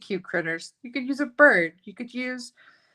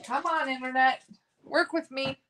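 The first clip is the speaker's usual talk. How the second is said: mock cheer; 7 semitones higher, 3 dB louder than usual. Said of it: "cheer for the internet".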